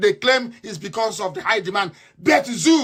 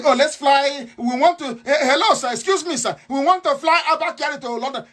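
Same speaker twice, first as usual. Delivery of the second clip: ape their fawning request, pitched up; mock begging officials; 6 semitones higher than usual. To listeners, mimicking officials begging to fly Kyari, high mock plea. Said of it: "When they were saying".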